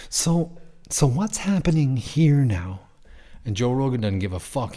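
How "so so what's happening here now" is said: high, slow, wide-eyed innocent voice; playing the puzzled judge, high and slow; innocent question. Impersonating the clueless judge to Joe Rogan, high and innocent. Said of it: "so innocently and just went".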